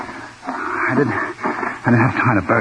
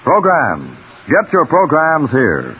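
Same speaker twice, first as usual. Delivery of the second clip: vendor calling out; 5 semitones higher, 3 dB louder, slower than usual. Acted.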